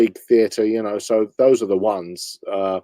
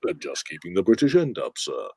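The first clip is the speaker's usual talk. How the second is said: lower, softer suave Bond quip; much softer Bond charm; Roger Moore; 5 semitones lower, 5 dB quieter than usual. Acted. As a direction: deliver the innuendo like a smug Bond. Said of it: "launched into Roger Moore".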